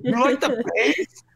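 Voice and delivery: retold club shout. Man retelling club chat-up to his ex, mock shouting. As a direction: imitate calling over a loud club. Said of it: "But it was so loud".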